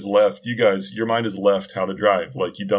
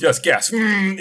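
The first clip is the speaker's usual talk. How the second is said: higher, louder imitation of a keen car; mock-eager car with engine noise; 9 semitones higher, 4 dB louder, slower than usual. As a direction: jump on it, then hum like a revving engine. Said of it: "it's very direct with the old Sentra".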